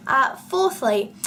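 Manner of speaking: usual speaking voice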